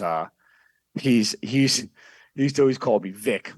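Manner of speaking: ordinary talk, nothing marked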